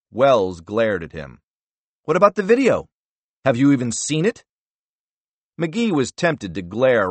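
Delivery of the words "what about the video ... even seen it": much higher and louder; angry demand. Acted. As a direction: jab the question at him. Senator demands angrily.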